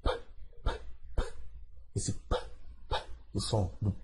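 tiny soft p sounds, repeated; isolated sound. Shown as a demonstration. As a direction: tap out the p sound softly. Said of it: "quando eu falo politician".

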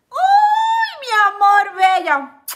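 high, loud delighted squeal on the greeting; excited squeal. Real, not acted. Greeting a viewer in the live chat, squealing with delight. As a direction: squeal with joy at seeing her name.

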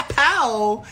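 a quick mock "Pow!" gunshot; gun sound. Shown as a demonstration. Friend asks his gun sound, mock "Pow!" gunshot. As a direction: pop out a playful "Pow!" like a gun firing. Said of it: "what did your gun sound like".

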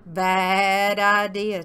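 loud, stretched-out disapproval; scolding rooster. A narrator's reading. Rooster to disguised Turkey, loud slow scolding. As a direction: drag the words out loudly.

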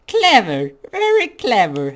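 high, loud sneering flower; mock praise, high and taunting; Flowey voice. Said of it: "my flowery voice".

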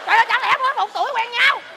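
high, loud disbelief; comic scandalized astonishment; incredulous outcry. Acted. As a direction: shout the question as if stunned. Shocked at gossip about an affair.

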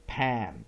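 pan drawn out very slowly, short a; slow short vowel demo. Shown as a demonstration. Teacher stretches pan for the class, very slowly. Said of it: "short vowels".

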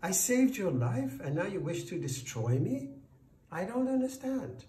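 high, loud, incredulous protest; bewildered, high-pitched outcry; crying out. A narrator's reading. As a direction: protest in dismay, pitch leaping up. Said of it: "cried the farmer".

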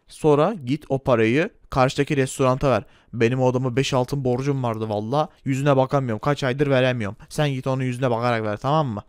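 low, bossy shopkeeper voice; gruff shopkeeper. Acted. Shopkeeper Erdal Bakkal teasing the customer, low gruff voice. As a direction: play the pushy corner-shop owner, pitched low.

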